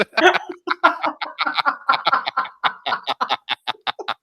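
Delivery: high, helpless cackle; long laugh. Real, not acted. After the Morlocks line, long high laugh. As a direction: let the laugh run on.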